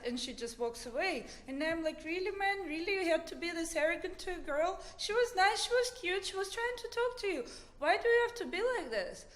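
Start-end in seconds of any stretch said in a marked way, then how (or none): 2.0-9.2 high, quick scolding; retold indignant reproach. Storyteller to the arrogant bassoon player, indignant reproach. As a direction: tell him off in a rush, pitched up. Scolding rude musician on woman's behalf.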